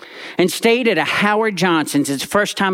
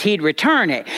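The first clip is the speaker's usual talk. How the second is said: usual speaking voice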